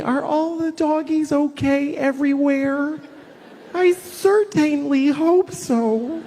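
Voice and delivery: high, slow, dreamy voice; mock sweet young man fretting over doggies; naive younger self. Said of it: "When I was, like, twenty".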